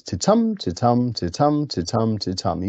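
"titum" with unstressed "ti", stressed "tum"; iambic stress. Shown as a demonstration. Teacher showing students iambic pentameter, "titum" stressed on second syllable. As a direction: chant "titum" as an even beat, hitting every "tum". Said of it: "unstressed then stressed syllables".

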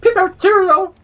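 high, loud mock-British cry; hammed-up British exclamation; British accent. Shown as a demonstration. Friend asked for their best British, hammed-up British accent. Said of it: "do your best British accent".

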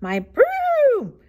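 high, loud trumpeting boast; elephant's shout. A narrator's reading. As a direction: trumpet the noise, then shout the brag. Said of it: "shouted the elephant".

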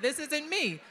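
ordinary talk, nothing marked

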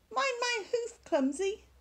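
high, cross little sheep voice. Acted. Sheep squabbling at bedtime.

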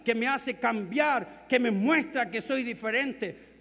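loud, high, driving pulpit voice; fiery sermon delivery; full-force preaching. Acted. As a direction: hammer the point home to the congregation.

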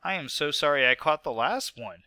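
usual speaking voice

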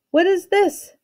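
high-pitched, demanding question. A narrator's reading. King to the servants rushing at him, demanding. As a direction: demand an explanation as a king would. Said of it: "the king demanded".

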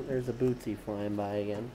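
usual speaking voice

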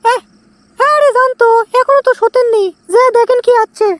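high put-on woman's voice; wife's voice. Young wife shooing her husband out, in a high put-on voice. Man voicing his wife.

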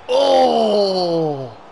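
long, loud "ohhh", high and falling; anguished "oh". Real, not acted. Streamer sees his shot hit the bar, anguished cry. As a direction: cry out at the near miss.

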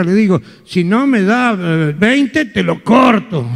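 put-on sly old grandmother telling her trick; grandma voice. Grandma tells her story.

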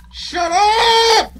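very high, loud scream; panicked shout. Real, not acted. Gamer screaming at the game to stop, panicked shout. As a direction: scream it at the screen. Yelling at scary game sound.

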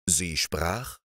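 plain reading voice

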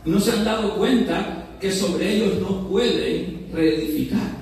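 ordinary delivery

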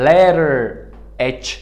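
American letter H, tapped t and r ending; American English. Shown as a demonstration. Teacher contrasts it for learners, American accent. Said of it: "And in American is".

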